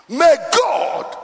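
high, loud pulpit cry; sudden forceful preacher's shout; shouted blessing. Real, not acted. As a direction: call the blessing out over the congregation.

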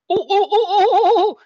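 high, loud ape hooting; ape chatter. Acted. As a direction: hoot wildly like an ape. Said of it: "the imp just starts chattering and going wild".

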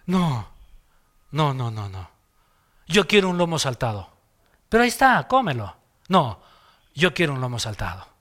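put-on a soft, mildly whiny little boy; stubborn child. Son refusing the food his dad serves, mild childish whine.

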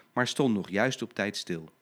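usual speaking voice

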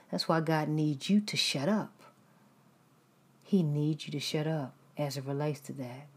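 usual speaking voice

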